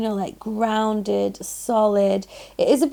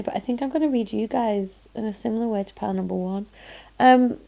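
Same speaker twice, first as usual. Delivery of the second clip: normal talking voice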